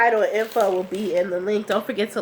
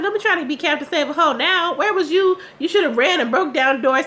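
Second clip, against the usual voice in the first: indignant scolding; 7 semitones higher, 3 dB louder than usual. Acted. Scolding a character on screen.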